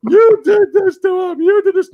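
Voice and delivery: very high, loud mock accusing parent; trailer parent accusation. Showing his wife the trailer, hammed-up high accusation. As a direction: overact the wronged parent. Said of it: "I was like".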